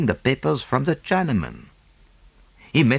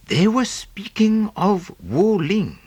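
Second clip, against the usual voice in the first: slow; 9 semitones higher, slower than usual. A narrator's reading. Secret overheard, slow.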